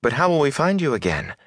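put-on anxious girl's high-pitched protest; high, questioning protest. A narrator's reading. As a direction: object in a high, pleading voice. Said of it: "Egwene protested".

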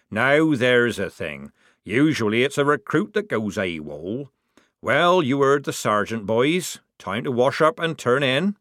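slow, dry officer drawl; officer dismissing recruits for the night; Scallett, sardonic. Acted. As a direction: lay on the irony. Scallett mock sergeant.

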